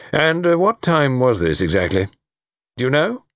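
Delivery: lightly voiced investigating officer; questioning colonel. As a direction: press politely for the exact time.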